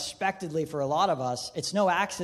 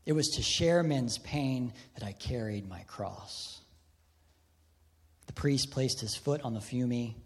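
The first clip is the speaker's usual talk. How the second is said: hushed story reading; 4 semitones lower, 4 dB quieter than usual. Acted. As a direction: read it low and hushed, letting the pauses breathe. Reads novel passage quietly.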